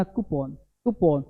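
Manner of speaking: कूपन as "koo-PON", low and soft; correct pronunciation. Shown as a demonstration. Teacher models the careful form, softly corrected. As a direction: drop low on कूपन with an "on" ending. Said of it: "ये हल्का सा प में लगाना".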